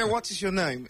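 "What is your name?" in a crisp British accent, pitched high. Shown as a demonstration. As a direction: do the posh Brit version. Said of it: "es británico".

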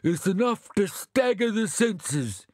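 high, slow mock horror; Sam aghast. A narrator's reading. Sam echoes Bill's outrage, high and hammed-up. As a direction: stagger them with his shock. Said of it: "said Sam".

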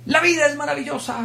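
high, joyful declaration; exuberant proclamation. Retold or imitated. Teacher telling the class, high and joyful. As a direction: announce it like the best news ever. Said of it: "Él solo decía".

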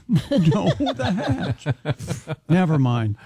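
usual speaking voice

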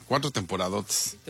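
low, dull, grudging delivery; grumpy, unenthusiastic. Real, not acted. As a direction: say it as if you don't want to be here. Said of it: "hablas como enojado".

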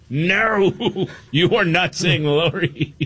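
high-pitched, laughing exasperation; hammed-up dad putting his foot down; mock parental scolding. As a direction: scold playfully through laughter. Dad forbidding British word, acted.

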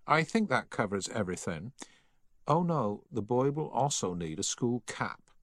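a hint of a stuffy tailor's assistant; lightly voiced, precise shop clerk; prim shop assistant. A narrator's reading.

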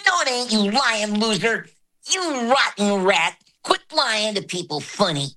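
high, taunting cartoon bully voice; high-pitched bratty rival hurling insults; Roger. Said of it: "he had this nemesis, Roger".

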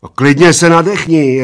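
loud, high urging; giant's advice. A narrator's reading. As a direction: egg him on loudly. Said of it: "radil mu obr".

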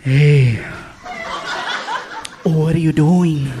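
slow seductive. Acted. Phone flirting, slow low.

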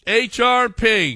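lingering radio-host letter call; drawn-out segment call. Pretend. Repeats segment name as announcement.

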